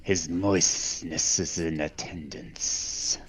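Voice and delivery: slow, low, hissing herald; hissing Gorn voice. Pretend. Player heralds Pope's attendance, hissing Gorn voice. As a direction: announce it slowly with lingering s sounds.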